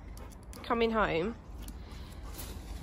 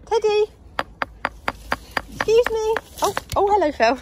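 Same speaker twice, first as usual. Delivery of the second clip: excited pet talk; 8 semitones higher, 7 dB louder than usual. Real, not acted. Greeting the dogs excitedly.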